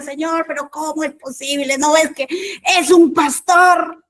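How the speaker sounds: rising to a loud high protest; anguished complaint. Retold or imitated. Weeping in the elevator, complaining loudly to God.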